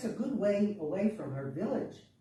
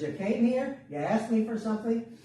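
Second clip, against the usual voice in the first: loud, higher-pitched rebuke; testy old woman; 6 semitones higher, 6 dB louder than usual. A narrator's reading. Old woman answers the confused girl, testy. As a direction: put the doubter in her place.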